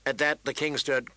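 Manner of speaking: ordinary delivery, nothing marked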